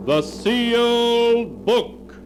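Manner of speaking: dramatic radio announcer; announcer title. Acted. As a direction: intone the show's name grandly.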